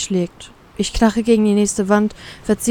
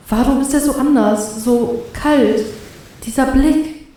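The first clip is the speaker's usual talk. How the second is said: higher, fearful wondering; unnerved; 4 semitones higher, slower than usual. A narrator's reading. Paralysed captive facing the captain's stare, unnerved. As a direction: think it aloud, unsettled. Captive's frightened inner thoughts.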